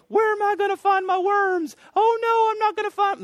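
high, loud, panicky little bird. Acted. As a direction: squawk it like a worried bird. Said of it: "That's my bird voice".

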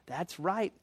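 soft, slow private musing; acted inner voice, hushed and slow; thinking to himself. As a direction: work it out quietly to yourself. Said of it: "you're thinking".

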